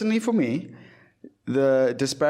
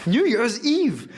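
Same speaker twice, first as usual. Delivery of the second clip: grumpy rant; 9 semitones higher than usual. Real, not acted. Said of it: "grumpy and tired".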